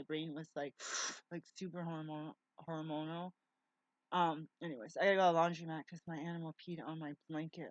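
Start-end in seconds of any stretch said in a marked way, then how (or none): none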